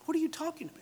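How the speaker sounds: high, hushed incredulity; high, small-voiced acted bewilderment; baffled disbelief. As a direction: ask it softly, utterly baffled. Philip baffled at Christ's order.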